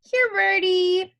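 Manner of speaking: put-on high, loud, drawn-out toddler call; singsong little-kid calling; little boy calling. A narrator's reading. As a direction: call the birds like an eager small child. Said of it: "he called".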